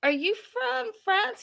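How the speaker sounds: high-pitched, drawn-out question; retold tentative question. Chatting with the neighbor, high slow questioning. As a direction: ask it slowly, voice way up high. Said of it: "and I was like".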